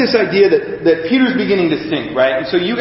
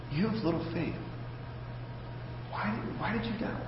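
much softer, close and intimate; private, personal rebuke; 22 dB quieter than usual. Retold or imitated. Jesus quietly asks sinking Peter one-on-one, hushed and personal. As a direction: say it just for him, softly up close. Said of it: "talking to him personally".

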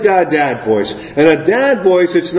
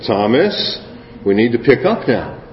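lower, firm dad voice; 6 semitones lower, 3 dB quieter than usual. Retold or imitated. Dad calling his toddler to pick up toys, lower firm dad voice. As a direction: drop into calm parental authority. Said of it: "I had to use the dad voice".

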